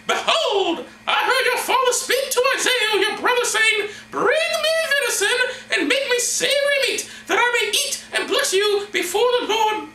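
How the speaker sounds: loud, very high comic falsetto; woman's voice. Acted. Rebekah reporting to her son Jacob, shrieky falsetto woman's voice. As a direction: squeal it out as a hammy falsetto woman. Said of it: "this is a woman's voice".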